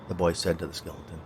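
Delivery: usual speaking voice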